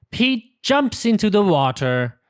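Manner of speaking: usual speaking voice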